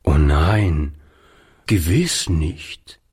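low, slow, firm "nein"; emphatic assurance. A narrator's reading. Old man reassuring the boy: emphatic, slow denial. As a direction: insist with weight on "nein". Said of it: "versicherte Oliver nachdrücklich".

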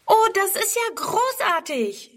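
put-on high, bright burst of child joy; jubilant girl. A narrator's reading. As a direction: cheer it out like a delighted little girl. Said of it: "jubelt Friedrun". (jubelnd, hoch und freudig)

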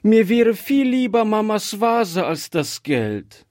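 put-on high-pitched, insistent little girl; distressed child's outburst; child crying out. A narrator's reading. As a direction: protest high, full of upset. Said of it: "rief Gretchen".

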